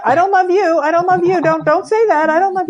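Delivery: hammed-up panicked refusal; mock panicked protest. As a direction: act him backing away in a panic. He panics at "I love you.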